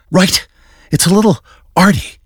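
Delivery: high, sniffy dismissive voice; sniffy Dent. Acted. Dent dismissing Batman's signal design, high and sniffy. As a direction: look down your nose at the art.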